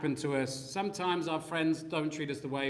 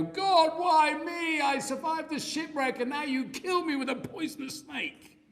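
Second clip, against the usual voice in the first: high, pleading "why me" wail; mock a self-pitying moan to God; whiny complaint; 11 semitones higher than usual. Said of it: "he did not go".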